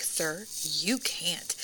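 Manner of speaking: low, slow, very soft imitation of staff; quiet refusal. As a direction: refuse softly, drawing the words out. Airline staff refusing the passenger, retold.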